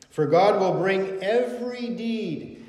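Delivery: preacher booming the warning verse; loud proclamation. Acted. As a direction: call it out over the congregation.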